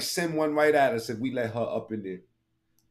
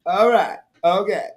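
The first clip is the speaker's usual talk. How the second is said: mock chirpy okays; 5 semitones higher, 5 dB louder than usual. Mimics clip.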